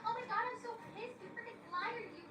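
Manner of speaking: very high, hammed-up tantrum whine; mock a whiny child, tailing down into blahs; childish whining. Girlfriend accuses boyfriend of lying, childish whining. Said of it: "She is acting like a child".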